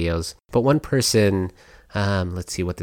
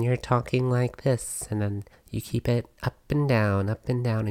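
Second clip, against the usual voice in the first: softer, lilting small-mouth feminine demo; small sing-songy voice; 3 semitones higher, 5 dB quieter than usual. Shown as a demonstration. Podcaster demonstrating smaller mouth plus musicality, sing-songy. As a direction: keep it small and lilt up and down. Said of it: "a little more sing-songy".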